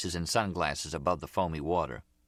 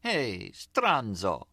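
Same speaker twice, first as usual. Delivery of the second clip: singsong, higher-pitched affected Italian; lilting Italian accent; 5 semitones higher, much slower than usual. A narrator's reading. Gloria mimics the name-calling for Nicky, lilting Italian accent. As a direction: call out with a put-on Italian lilt. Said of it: "getting a lilt in her voice".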